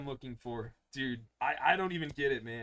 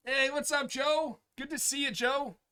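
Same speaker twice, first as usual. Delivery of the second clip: cheerful greeting; 9 semitones higher than usual. Real, not acted. Greeting chat viewer cheerfully.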